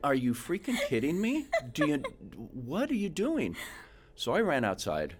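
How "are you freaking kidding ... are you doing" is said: high, incredulous, the last words slowed; can't believe what he's seeing; stunned disbelief. Retold or imitated. Shocked thought at naked daughter.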